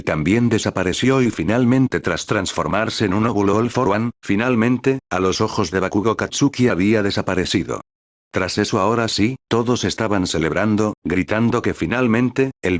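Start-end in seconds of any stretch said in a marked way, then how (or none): none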